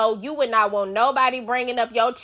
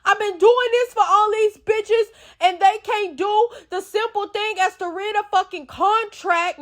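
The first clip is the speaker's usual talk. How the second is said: mock Danny bragging about her loyalty; imitating Danny; 9 semitones higher than usual. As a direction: mimic her self-important complaint. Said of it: "she like".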